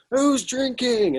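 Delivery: high, slow, loud rallying call; Irish frontman revving up the pub crowd, slow loud call; charismatic Irish guy. Retold or imitated. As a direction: rouse the crowd like a showman frontman. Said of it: "this sort of Irish guy".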